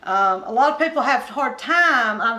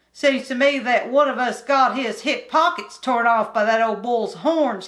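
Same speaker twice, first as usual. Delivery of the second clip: put-on bored country boy; 4 dB louder than usual. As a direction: whine it like a restless mountain kid. Kim's dialogue.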